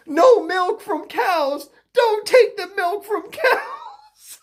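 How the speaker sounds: high, slow mock protest cry; high-pitched mocking chant; mocking protesters. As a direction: draw it out like a wailing protester.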